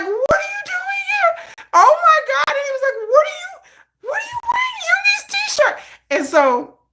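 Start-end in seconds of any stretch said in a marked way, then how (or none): 0.0-5.8 shocked squeal. Retold or imitated. [name] catching her in [name]'s shirt, high shocked wail.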